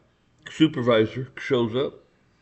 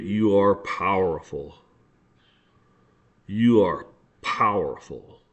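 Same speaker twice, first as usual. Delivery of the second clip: slow, lower, weighty delivery; emphatic, deliberate; 6 semitones lower, slower than usual. Real, not acted. Speaker telling listeners they hold power, deliberate and emphatic. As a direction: draw it out and let each word land.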